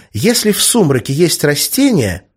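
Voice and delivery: high-voiced child explaining eagerly; little girl. A narrator's reading. Little daughter explains from the back seat, eager child voice.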